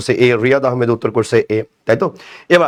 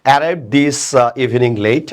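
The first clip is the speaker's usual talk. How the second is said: slow reading; much slower than usual. Shown as a demonstration. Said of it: "দেখেন".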